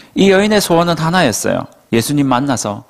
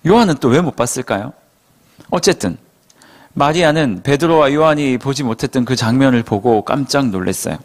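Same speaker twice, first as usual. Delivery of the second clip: ordinary delivery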